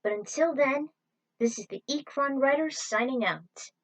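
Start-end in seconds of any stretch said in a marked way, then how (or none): none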